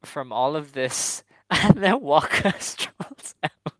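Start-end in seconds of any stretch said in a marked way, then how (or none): 1.5-3.7 louder, slow words broken by laughs; laughing. Real, not acted. Wrestling fan retells a contest entrance, laughing. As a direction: crack up while telling it.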